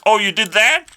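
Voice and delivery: high, loud incredulous question; society's mock surprise. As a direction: act out an astonished onlooker. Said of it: "and then society's like".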